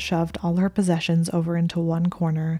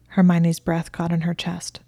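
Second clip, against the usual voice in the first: plain reading voice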